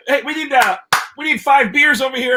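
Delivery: high, carrying retold order; calling out. Fake waiter ordering beers for the table, calling out. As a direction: call the order across the bar.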